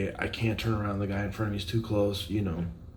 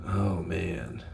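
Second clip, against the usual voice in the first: ordinary delivery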